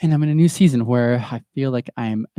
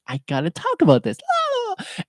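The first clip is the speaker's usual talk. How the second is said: mock excitement; 4 dB louder than usual. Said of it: "I'm like".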